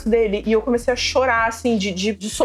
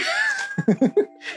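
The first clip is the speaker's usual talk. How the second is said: 1 high burst of laughter. Real, not acted. Laughs before the punchline.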